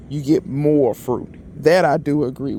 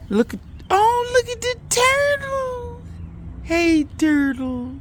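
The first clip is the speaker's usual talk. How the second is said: put-on very high, slow cooing baby voice; cooing baby talk; slower than usual. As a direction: coo at the little turtle like it's a baby. Cooing at a turtle.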